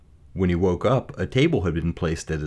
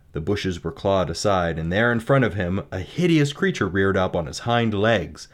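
usual speaking voice

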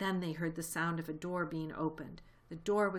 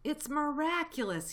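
plain reading voice

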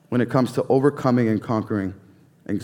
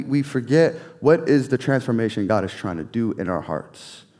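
ordinary talk

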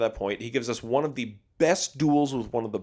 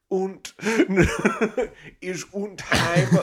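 mock German voice; 5 semitones higher, slower than usual. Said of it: "German kind of Swedish voice".

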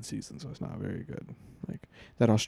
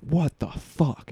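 retold outburst; 5 semitones higher, 4 dB louder, much slower than usual. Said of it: "and out loud, I went".

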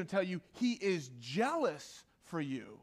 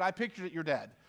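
ordinary talk

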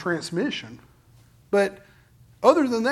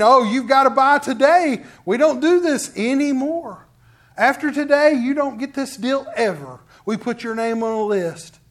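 loud, pushy sales pitch voice; mock high-pressure salesman; 4 semitones higher, 6 dB louder than usual. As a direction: push the deal hard like a timeshare seller. Salesman pushing a quick sale.

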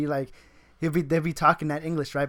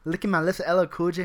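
ordinary talk

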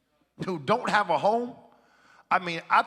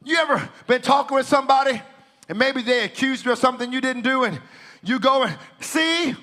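loud, high declaring preacher; full-force preaching; 11 semitones higher, 10 dB louder than usual. Acted. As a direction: proclaim it like a revival preacher. Preaching to congregation.

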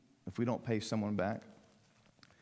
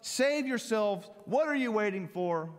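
pleading criminal; 9 semitones higher than usual. Acted. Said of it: "they said this".

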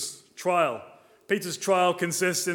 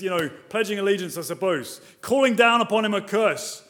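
normal talking voice

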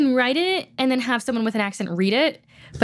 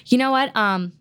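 normal talking voice

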